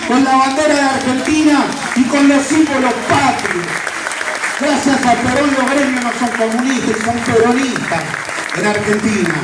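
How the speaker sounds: rising political oratory. Real, not acted. Rally speech building in pitch.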